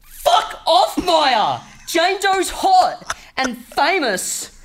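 high, full-out acted outburst; furious shout. Actor as Josh rejecting Maya in a scene, furious shout. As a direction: shout it high, stretching the last words. Acting the scene's angry reply.

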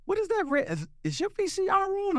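high, lightly voiced worried friend; a hint of a suspicious buddy asking; voicing a friend. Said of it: "He was like".

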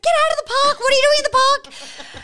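high, loud alarmed mother's voice; panicked mum. Retold or imitated. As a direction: shriek it like a frantic mum on the phone. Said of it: "She's like".

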